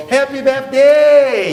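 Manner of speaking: teacher leading the class in a loud, slow birthday wish. Shown as a demonstration. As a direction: belt out Happy Birthday loudly and slowly. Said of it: "On peut dire encore plus fort".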